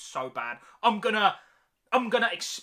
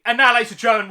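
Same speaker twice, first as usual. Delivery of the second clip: announcer hype; 9 dB louder, much faster than usual. Acted. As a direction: announce it like a countdown show host. Countdown host announcing the ranking.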